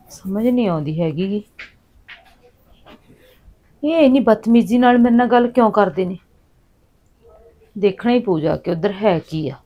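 thinking aloud, hurt; muttering to herself. Acted. Wife talks to herself alone. (ਆਪਣੇ ਆਪ ਨਾਲ ਹੌਲੀ ਬੁੜਬੁੜਾਉਣਾ)

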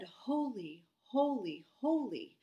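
holy, holy, holy rising into loud calls; crying out holy. Acted. Seraphim crying to each other, loud building holy calls.

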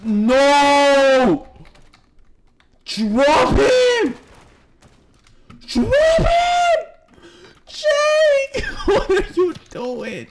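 high, loud panicked yells at a teammate; shrieking at Jake in panic; frantic shouting. Real, not acted. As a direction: scream at Jake to drop him.

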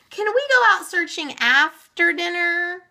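put-on high, eager child's plea; child begging permission; pleading. A narrator's reading. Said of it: "pleaded Meg as they ate".

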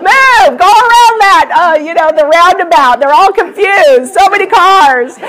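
high, loud mock road rant; comic exasperated yelling at traffic; imitated freak-out. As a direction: act out a frantic, impatient driver. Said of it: "Carrie's not freaking out right now".

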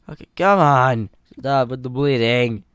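loud exasperated shout; real frustration at the bleeding; frustrated yell. Real, not acted. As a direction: yell at the game to stop.

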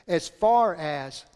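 "As far as" drawn out slowly, each word weighted; slow emphasis. Shown as a demonstration. Pastor slowly weighting "As far as" for the congregation. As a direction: lean into "As far as". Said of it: "as far as means there is a distance".

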